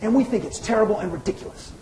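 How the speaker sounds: lightly voiced disapproving caller; imitating the reporter. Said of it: "She says".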